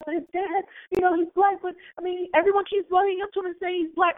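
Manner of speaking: high mock-indignant friend voice; mimicking the friend. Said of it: "She's like".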